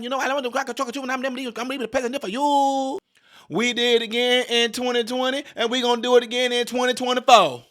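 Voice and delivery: high, rushed parody voice; Kamala impression. Parodying Kamala promising voters, high and fast. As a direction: gabble it high like a mocking politician. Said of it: "cackling Kamala Harris".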